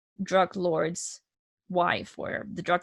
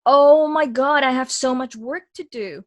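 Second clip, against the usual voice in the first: loud, high cry of sudden realization; big dramatized "aha" outburst, fading; acted-out revelation; 6 semitones higher, 13 dB louder, slower than usual. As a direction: belt it out like an epiphany, then ease down. Acts out sudden realization at Broadway show.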